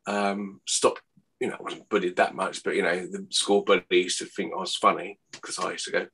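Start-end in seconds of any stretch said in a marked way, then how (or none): none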